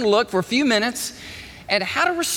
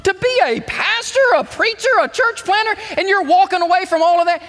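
high, astonished disbelief at the choice; incredulous friend; 12 semitones higher, 3 dB louder than usual. Retold or imitated. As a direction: scold him as a baffled friend would. Friends confronting pastor over leaving.

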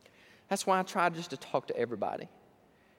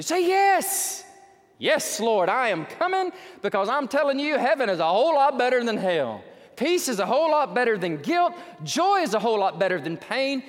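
loud, high, rousing exhortation; impassioned preaching; 4 semitones higher, 4 dB louder than usual. Real, not acted. Preacher urging the congregation to say yes to Jesus, rousing. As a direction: open with a shout and keep driving hard.

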